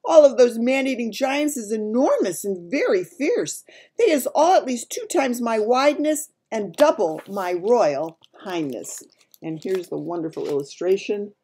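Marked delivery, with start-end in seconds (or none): 0.0-9.0 louder, higher giant, slowing to finish; emphatic giant. A narrator's reading. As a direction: insist as an alarmed giant.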